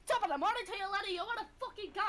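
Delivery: put-on a light Irish accent picking a fight; practised Irish accent, tough-guy pose. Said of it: "Let me practice my Irish accent real quick".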